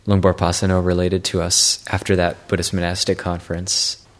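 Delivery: ordinary talk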